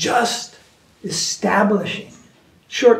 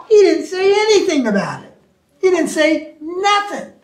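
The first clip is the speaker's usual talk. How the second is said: higher and louder, hammering the point; emphatic retort; 9 semitones higher, 4 dB louder than usual. Retold or imitated. Retelling his reply to the swami, higher and louder. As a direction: insist he said nothing at all, high-pitched. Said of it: "I said".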